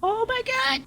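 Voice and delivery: rising tense don't move chant; panicked pleading. Real, not acted. Monster nearby, frozen.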